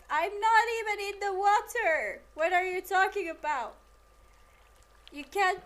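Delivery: loud, high-pitched protest at the game; exasperated outburst. Real, not acted. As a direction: raise your voice in disbelief, indignant. Arguing with the game's water.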